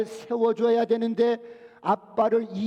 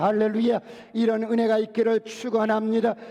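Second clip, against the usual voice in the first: louder fervent sermon blessing; full-voiced pulpit blessing; preaching blessing; 4 dB louder than usual. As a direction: proclaim it to the congregation.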